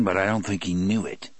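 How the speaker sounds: plain reading voice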